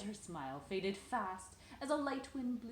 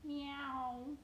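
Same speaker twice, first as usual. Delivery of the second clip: cat meow; 5 semitones higher, 4 dB louder than usual. Pretend. Mother meows with toddler.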